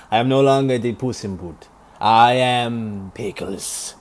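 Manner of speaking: a light, self-admittedly bad Spanish Puss in Boots accent; hammed-up Spanish cat swordsman accent, dragging I am Pickles slowly; attempted Puss accent. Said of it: "I can't do his accent".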